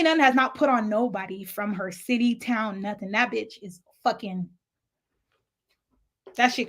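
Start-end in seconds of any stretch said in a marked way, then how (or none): none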